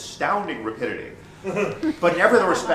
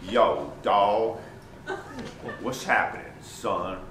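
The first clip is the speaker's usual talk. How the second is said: lower, laid-back dealer greeting; dealer's voice; 7 semitones lower, 4 dB quieter, slower than usual. Retold or imitated. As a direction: drop low and lazy like the dealer.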